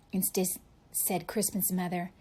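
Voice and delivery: ordinary delivery, nothing marked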